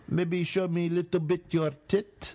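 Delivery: put-on a lightly done Czech accent, coaxing; Czech porn producer.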